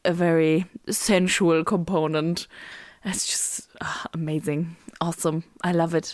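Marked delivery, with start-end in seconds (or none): 3.1-4.8 breathy dreamy whisper. Real, not acted. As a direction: whisper it, swooning. Sensual blood feeding.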